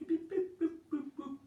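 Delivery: high-pitched machine beeps and boops; beeping noises. Retold or imitated. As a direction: chirp them like a keypad. Mimicking office machine noises.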